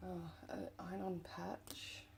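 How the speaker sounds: soft hushed whisper to herself. Real, not acted. Whispering to herself while sorting through her finds. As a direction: breathe it out like a secret. Murmuring over a find.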